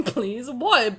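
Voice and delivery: ordinary talk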